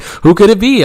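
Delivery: high, loud dramatic wondering; mock wondering. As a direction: ham up the mystery. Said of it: "I was like".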